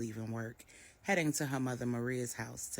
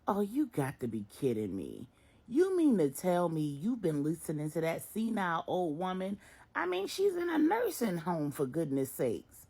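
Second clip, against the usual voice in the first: louder, incredulous; scoffing son; 5 semitones higher, 6 dB louder than usual. A narrator's reading. Marcus scoffs at his mother for trusting a senile woman. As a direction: scoff at the idea.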